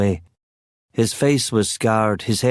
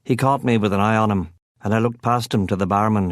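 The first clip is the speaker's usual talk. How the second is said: usual speaking voice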